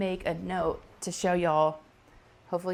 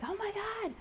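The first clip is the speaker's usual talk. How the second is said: high, breathy, softer gasp of horror; horrified gasp; 14 semitones higher, 9 dB quieter than usual. Retold or imitated. Mom seeing the squashed bug twitch, horrified high gasp. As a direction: gasp it out, much higher and quieter. Grasshopper's legs start moving.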